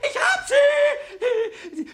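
loud, very high, drawn-out cry of victory; triumphant squeal. Acted. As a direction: crow over your catch. Has just caught the mouse. (jubelndes Kreischen)

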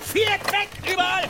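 high, loud order; shouted command. Acted. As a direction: shout it across the deck. Ahab ordering boats lowered. (hoher, lauter Befehl)

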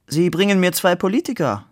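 doctor amused at the two wounded politicians, smiling. A narrator's reading. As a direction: ask it with a smile. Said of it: "fragte er lächelnd".

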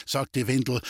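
ordinary delivery

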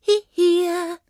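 high, loud gnome call. Acted. Gnome calling up to the centaur, high call.

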